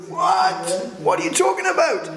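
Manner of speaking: high-pitched incredulous outburst; shocked disbelief. Retold or imitated. Peter reacting to seventy times seven, shocked disbelief. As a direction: play Peter aghast, pitch shooting right up.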